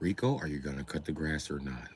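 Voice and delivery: very low, soft employer voice; straight-laced boss. Acted. Boss asks worker about cutting grass, low soft voice. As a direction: drop deep and quiet, a formal boss asking.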